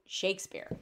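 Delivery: normal talking voice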